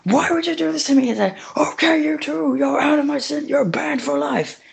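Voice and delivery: high-pitched cop banning them, slowing at the end; a put-on high voice laying down a lifetime ban; mad cop voice. Barricade the cop banning Optimus and Megatron, high and mad.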